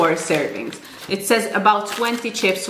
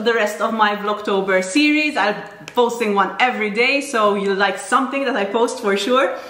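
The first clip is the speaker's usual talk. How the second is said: ordinary talk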